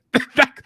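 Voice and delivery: high burst of laughter; a squeal of laughter. Real, not acted. Laughing at live bettors.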